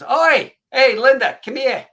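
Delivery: loud, high-pitched holler; calling out. Retold or imitated. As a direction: call someone over from across a hangar. Skydiving guy calls Linda over.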